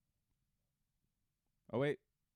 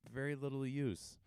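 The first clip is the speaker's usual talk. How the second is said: normal talking voice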